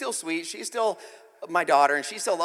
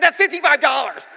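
loud high insistence; mock outraged father; exasperated dad; 8 semitones higher, 10 dB louder than usual. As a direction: hammer the price home. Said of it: "I said".

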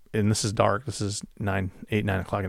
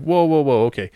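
higher and louder, a retold jolt of alarm; startled whoa; 6 semitones higher, 6 dB louder than usual. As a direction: blurt it out like something just spooked you. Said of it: "And I'm like".

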